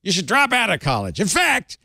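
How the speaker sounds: Colonel Sanders voice. Retold or imitated. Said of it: "making him sound like Colonel Sanders".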